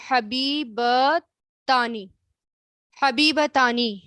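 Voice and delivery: حبیبتانی stretched out very slowly, each syllable held; drawn-out model word. Shown as a demonstration. Teacher to students, stretching out the new word. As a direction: sound out حبیبتانی at half speed. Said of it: "حبیبہ کس کو کہتے ہیں؟".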